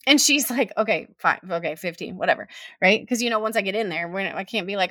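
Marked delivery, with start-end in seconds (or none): 0.8-2.5 low, resigned imitation of mom; grudging acceptance. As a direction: give in grudgingly, dropping the pitch. Mom grudgingly accepts fifteen minutes.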